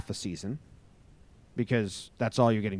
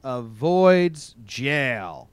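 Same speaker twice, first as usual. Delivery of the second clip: news read; 5 semitones higher, 6 dB louder, much slower than usual. Acted. Reads news headline.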